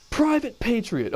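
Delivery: high-pitched, drawn-out roll-call shout; drill sergeant. Retold or imitated. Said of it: "He goes".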